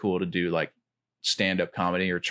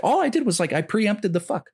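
high, excited comic punchline; imitating Bill Burr; 12 semitones higher, 3 dB louder than usual. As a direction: squeal it out indignantly high. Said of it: "he's like".

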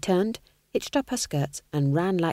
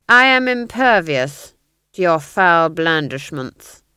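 pompous villain Duke; 7 dB louder than usual. A narrator's reading. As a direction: proclaim the threat with ponderous menace. The Duke threatens his prisoner.

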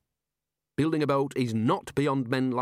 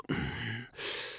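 embarrassed throat clearing; 9 dB quieter than usual. Acted. Embarrassed pause in answer.

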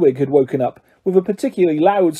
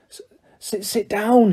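stammering, tearful elf wail; 4 semitones higher than usual. A narrator's reading. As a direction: wail SIT DOWN, higher and broken up. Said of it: "he wailed".

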